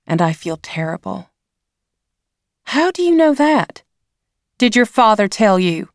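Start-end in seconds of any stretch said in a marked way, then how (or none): 2.6-5.9 high, loud angry demand; mother rounds on her daughter, upset; angry mother confronting her child. A narrator's reading.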